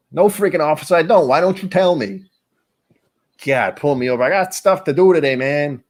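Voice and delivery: high-pitched griping driver voice; high, exasperated fake voice; put-on voice for a cop. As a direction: whine back at the officer in a put-on high voice. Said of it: "no idea what you actually sound like".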